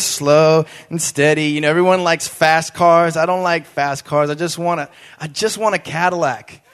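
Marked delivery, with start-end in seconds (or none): none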